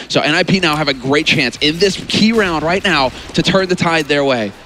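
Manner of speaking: normal talking voice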